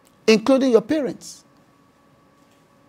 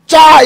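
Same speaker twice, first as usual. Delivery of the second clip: rebuking shout; 6 semitones higher, 10 dB louder than usual. Shown as a demonstration. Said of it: "you do that and just run".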